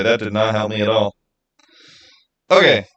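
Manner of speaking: ordinary delivery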